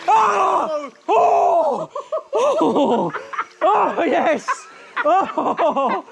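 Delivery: high laughing shouts of shock; laughing yelps. Real, not acted. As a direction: whoop and laugh through the cold. Laughing shouts in cold water.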